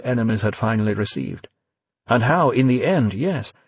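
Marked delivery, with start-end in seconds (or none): none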